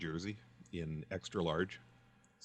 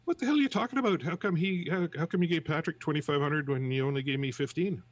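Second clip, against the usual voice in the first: much higher, louder furious demand; indignant outburst; 9 semitones higher, 7 dB louder than usual. Acted. Johnny yelling at the unemployment clerk, furious shout. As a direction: shout it, outraged at being shortchanged. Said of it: "He says".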